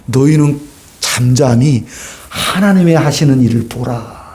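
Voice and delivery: low, hushed, steady command to be still; calm Moses. Acted. Preacher voicing Moses at the Red Sea, low and calm. Moses calmly tells people to watch.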